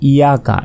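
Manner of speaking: ইয়াকা said quickly with a single short y; ইয়াকা as a common learner's slip, undoubled y; mispronunciation demo. Shown as a demonstration. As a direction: rush ইয়াকা without doubling the y. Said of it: "এটা কিন্তু ভুল".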